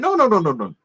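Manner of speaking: ordinary talk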